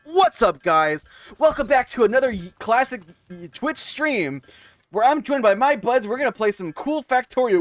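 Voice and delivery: high, loud hyped intro voice; chirpy over-the-top YouTuber greeting; Minecraft YouTuber voice. Retold or imitated. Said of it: "fucking Minecraft YouTuber voice".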